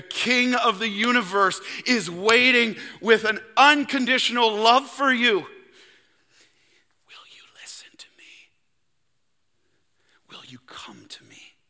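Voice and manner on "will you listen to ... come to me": God calling gently, whispered; whispered invitation. Pretend. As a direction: whisper it like a tender call. God's invitation, whispered intimately.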